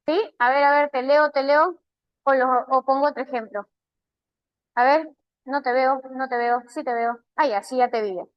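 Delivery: normal talking voice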